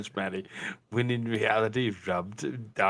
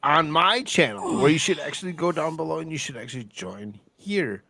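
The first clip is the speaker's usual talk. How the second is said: excited promo; 5 semitones higher, 6 dB louder than usual. Acted. Channel plug, shouted.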